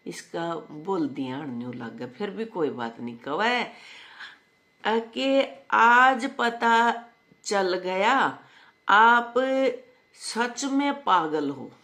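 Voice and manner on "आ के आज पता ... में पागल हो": high, slow word-by-word quoting; drawn-out read-out. Retold or imitated. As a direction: stretch each phrase with long pauses. Reads out a woman's comment, drawn out.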